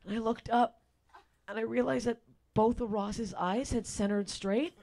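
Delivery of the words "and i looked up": ordinary delivery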